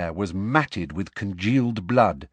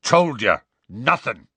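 firm, emphatic denial; 2 semitones higher, 5 dB louder than usual. A narrator's reading. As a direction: shut the question down. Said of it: "said Hagrid firmly".

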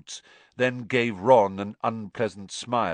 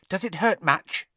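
high, simpering concern; 10 semitones higher, 3 dB louder than usual. A narrator's reading. Pansy asking about Draco's injured arm, simpering. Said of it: "simpered Pansy Parkinson".

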